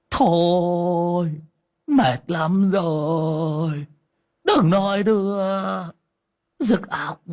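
put-on an old man groaning in bed to silence his wife. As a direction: moan each word out like it hurts. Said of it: "Lão rên rỉ".

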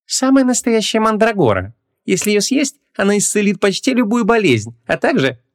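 high, gushing salesman voice; hard-selling shopkeeper. A narrator's reading. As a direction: talk up a dubious remedy. Shopkeeper hawking a fake mandrake.

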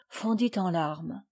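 ordinary delivery, nothing marked

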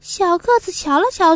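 ordinary delivery

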